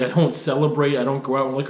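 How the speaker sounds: normal talking voice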